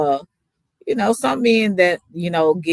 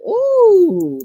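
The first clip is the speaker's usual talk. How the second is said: surprised; 14 semitones higher, 5 dB louder than usual. Real, not acted. Exclaiming at the question.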